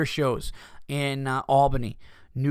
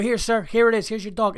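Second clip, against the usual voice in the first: high, louder acted impatience; exasperated handover; 9 semitones higher, 4 dB louder than usual. Owner handing sir his dog's leash, fed up. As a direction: push him off with mock courtesy.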